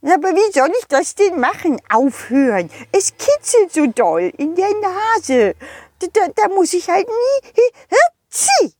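high, fast complaining snowman voice; the snowman pleads it tickles so much; whiny snowman. Acted. (hohe, quengelnde Schneemannstimme)

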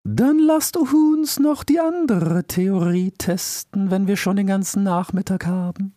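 high, slow, measured elder voice; solemn, careful old owl; wise old owl. Acted. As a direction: weigh each word like a cautious sage.

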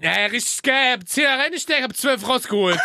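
high-pitched, hammed-up delight at winning; mock gambler's boasting. Host plays a slot player boasting of winnings, high mock excitement. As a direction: brag like a thrilled small-time gambler.

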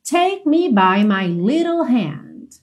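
slowly articulated English; slow model line. Shown as a demonstration. Teacher modelling a song line for students, slow and clear. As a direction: draw each word out for learners.

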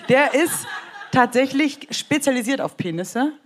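trying not to laugh; words breaking into giggles; stifled giggles. Real, not acted. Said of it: "hör auf zu lachen". (unterdrücktes Kichern)

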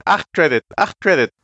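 high, pushy Jawa pidgin; squeaky trader voice, broken speech; broken Basic. Acted. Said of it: "als würde ich schlecht Basic sprechen".

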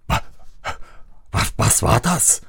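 breathless frightened stutter; startled thief stammering. A narrator's reading. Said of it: "sprach der eine Dieb erschrocken".

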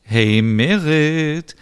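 high, loud and slow; cheerful call. Acted. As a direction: sing out a greeting. Smilla calls, helps.

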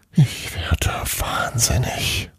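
soft whispered despair; quietly. A narrator's reading. Exhausted Santa muttering after his tantrum, whispered. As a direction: breathe it out, worn out. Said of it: "sagte er leise".

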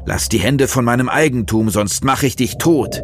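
furious rant. Acted. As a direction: threaten like the devil himself. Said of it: "zitternd vor Wut".

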